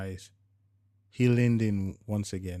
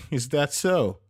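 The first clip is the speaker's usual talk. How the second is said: higher, louder teasing challenge; wife pretending to doubt her husband; mock serious; 7 semitones higher, 8 dB louder, slower than usual. A narrator's reading. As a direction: feign suspicion with a knowing edge. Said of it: "she responded".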